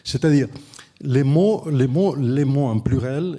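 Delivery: French with a Catalan speaker's accent; non-native French, from across the Pyrenees; foreign accent in French. Real, not acted. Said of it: "excusez-moi mon français".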